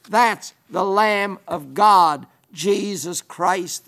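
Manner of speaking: usual speaking voice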